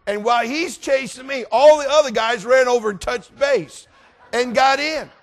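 high, loud storytelling peak; worked-up climax. A narrator's reading. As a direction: shout the punchline of the chase. Shouted climax of chase story.

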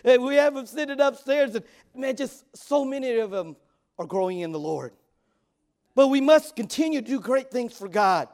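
loud, high fervent preaching. Real, not acted. Pastor preaching loud to the congregation. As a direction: preach it out to the church.

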